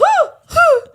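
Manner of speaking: very high, loud whoops; cheering. Real, not acted. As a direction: whoop like at a party. Friend cheering the story.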